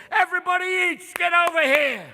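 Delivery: high, loud summons; comic full-voiced call to a crowd; calling the gang. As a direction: holler everyone over to eat. Raccoon calls others to the feast.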